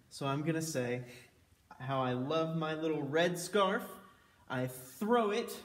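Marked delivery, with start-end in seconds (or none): none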